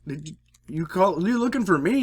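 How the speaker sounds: loud and high, calling out to the cop at the door. Retold or imitated. Man calling out to a cop at his door, loud and high. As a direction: pitch it up as if calling through a door.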